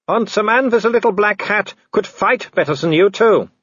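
high, loud taunting villain; Wolfgang. Acted. Werewolf taunting the man up the tree, high mocking call. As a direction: call out mockingly to cornered prey.

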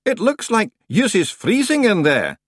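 a rustic Scot at the door; Scottish-ish stranger. A narrator's reading.

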